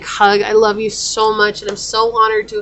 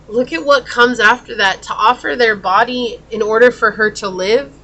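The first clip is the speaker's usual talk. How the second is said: normal talking voice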